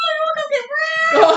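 high squeal. Shown as a demonstration. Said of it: "Like that".

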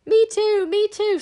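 very high, loud squeak; squeaky. Acted. Little Squeeze Juice begging to join, squeaking. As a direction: squeak it out like a tiny eager creature. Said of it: "squeaked Squeeze Juice".

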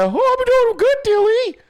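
high, loud mock-feminine falsetto; hammed-up girly squeal; lightened girly voice. Shown as a demonstration. Showing the worker how to sound like a girl, high mock falsetto. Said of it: "You didn't even lighten the voice".

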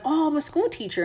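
high, innocent-sounding reply; breezy pretend answer. Traveler lying about her job to nosy workers, mock innocent. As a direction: pitch it up like harmless small talk. Acting out her casual lie.

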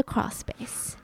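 hushed whisper for suspense. Real, not acted. Telling a friend about the crawl space, whispered. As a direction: whisper it to build dread.

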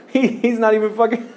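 fast, high, laughing through words. Real, not acted. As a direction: talk while cracking up. Laughing while speaking.

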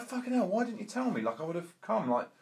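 high-pitched retold complaint to a mate; annoyed row. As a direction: act out an indignant telling-off. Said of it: "they're having a massive row".

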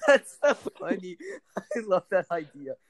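slowing down as the laughter takes over; laughing delightedly. Real, not acted. Cracking up at a friend's cherry description, laughing through the words.

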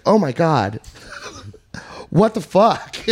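high, drawn-out mock astonishment; shocked friend. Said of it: "And he's like".

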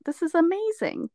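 high, wide-eyed retold awe; amazed. Tourist arriving at the airport, high amazed self-imitation. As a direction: play your own dazzled arrival, pitched high. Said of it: "I was like".

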